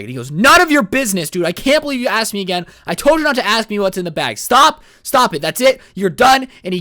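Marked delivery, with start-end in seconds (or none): 0.3-1.5 loud, high furious yell; angry shout. Retold or imitated. Hitchhiker snaps, angry quarrel. 1.5-6.5 loud, higher furious yelling, slowing on stop it; angry shouting. Retold or imitated. Hitchhiker's angry outburst in car.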